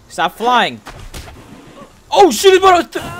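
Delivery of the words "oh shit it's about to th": sudden panicked cry, high and fast. Real, not acted. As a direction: blurt it in alarm. Monster about to kill him.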